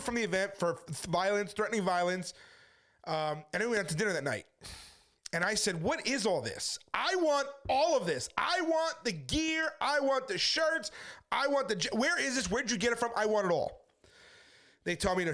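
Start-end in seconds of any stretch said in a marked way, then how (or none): 5.8-13.8 high, stretched-out wanting; eager excitement. Retold or imitated. A preacher tells new friends at dinner he wants their gear, excited.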